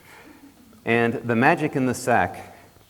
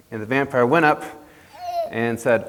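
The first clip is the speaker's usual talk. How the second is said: plain reading voice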